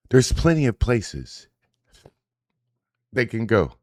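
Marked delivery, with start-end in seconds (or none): none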